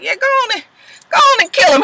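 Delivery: high mock-eager push; egging him on. Elizabeth urging Huck to kill, high and goading. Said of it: "She was just like".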